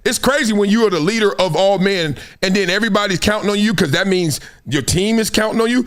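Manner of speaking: ordinary talk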